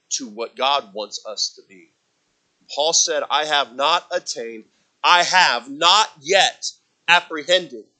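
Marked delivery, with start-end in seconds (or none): 2.7-7.9 loud, slow preaching; full-voiced sermon delivery. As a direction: proclaim it from the pulpit. Preaching scripture.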